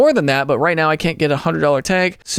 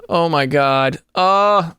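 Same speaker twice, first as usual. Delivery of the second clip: ordinary delivery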